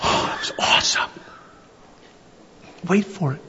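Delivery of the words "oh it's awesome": whispered awe. Shown as a demonstration. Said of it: "You do a".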